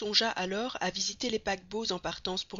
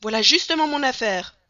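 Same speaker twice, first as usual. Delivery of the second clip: loud, high delighted outburst; excited; 5 semitones higher, 12 dB louder than usual. Acted. Passepartout reading the troupe's poster, delighted shout. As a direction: shout it like a lucky break. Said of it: "s'écria Passepartout".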